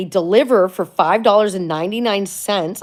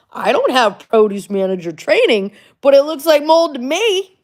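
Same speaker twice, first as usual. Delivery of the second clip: louder and higher, indignant; 7 semitones higher, 7 dB louder than usual. Real, not acted. Vlogger telling viewers, indignant and loud. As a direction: insist it's mold, exasperated. Indignant aside about the mold.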